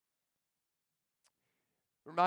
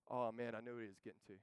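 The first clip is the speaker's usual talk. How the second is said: ordinary talk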